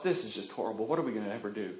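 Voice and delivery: understated despair of the couple; mock despair. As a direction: sink into dismay.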